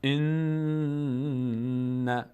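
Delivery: "ان" with the n held very long in the nose; chanted "ان" with lingering nasal n; ghunnah. Shown as a demonstration. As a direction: hum the n of "ان" on a sustained note.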